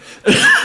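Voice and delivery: sudden hard loud cough; coughing fit. Real, not acted. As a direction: cough as tea goes down the wrong pipe. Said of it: "goes down the wrong pipe".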